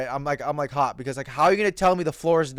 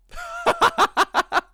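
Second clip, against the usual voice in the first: high burst of laughter. Real, not acted. Laughs at his own story.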